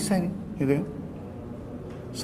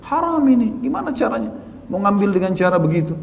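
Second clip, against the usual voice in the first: sharply higher, indignant cry; indignant outburst; 7 semitones higher than usual. Real, not acted. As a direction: exclaim in disbelief at the sin. Preacher condemning taking money.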